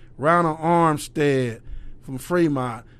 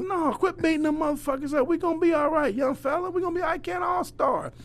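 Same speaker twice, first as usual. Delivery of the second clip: higher pitched, half-joking scold then reassurance; mock scolding; 11 semitones higher than usual. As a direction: scold them, then promise it'll be fine. Coach scolding and reassuring kids.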